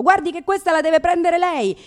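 imitated nurse yelling; mock yelling nurse. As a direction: shout down the corridor in mock panic.

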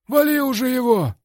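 very high, loud yell; impulsive yell. A narrator's reading. Coach yells at the fighter through the cage, impulsive scream. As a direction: scream the order in a burst of feeling. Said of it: "в порыве чувств заорал".

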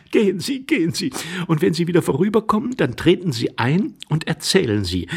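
lively lady. A narrator's reading. Lady telling the intruder to report back, lively. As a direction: urge him off briskly. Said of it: "rief die Dame lebhaft".

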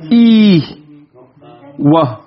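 long drawn-out "E", pause, then "wa"; contracted form. Shown as a demonstration. Teacher showing students how a common oath shortens, contracted form. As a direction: stretch "E" loudly, then drop onto "wa". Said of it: "it becomes not what?".

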